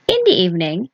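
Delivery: usual speaking voice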